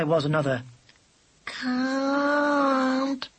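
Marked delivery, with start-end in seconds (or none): 1.4-3.3 high, whining little kitten. A narrator's reading. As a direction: whine it like a hurt kitten. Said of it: "still in a kitten's voice".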